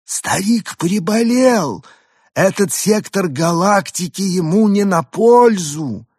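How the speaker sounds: high, slow put-on alien voice. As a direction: stretch it out like a strange alien. Arthur voicing alien. (высокий протяжный голос пришельца)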